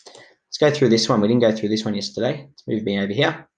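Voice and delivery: ordinary talk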